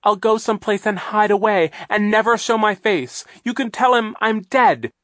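high, loud desperate begging; desperate pleading. Acted. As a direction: beg for your life in panic. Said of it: "Aunt Josephine said desperately".

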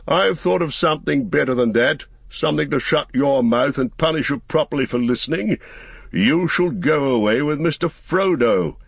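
high, emphatic wizard pronouncing sentence; Gandalf, mock-stern. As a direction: announce a punishment with relish.

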